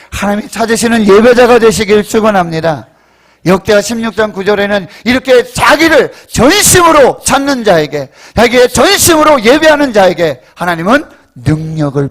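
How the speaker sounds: loud, emphatic pulpit delivery; full-force preaching. Real, not acted. Preacher blessing worshippers and citing Chronicles, forceful. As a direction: proclaim it to the whole congregation.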